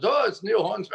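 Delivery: high, put-on British accent; Neil Hornsby. Guest imitating the British caller introducing himself, shaky-attempt British accent. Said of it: "with this British accent".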